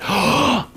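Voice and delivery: high, sharp mock gasp; excited gasp. Coach imitating a girl's sparked emotions, excited gasp. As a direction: gasp as if suddenly hooked. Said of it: "emotional investment".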